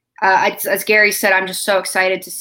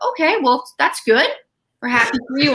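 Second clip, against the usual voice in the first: polite approval; 5 semitones higher than usual. Retold or imitated. Retells family's polite reaction to news.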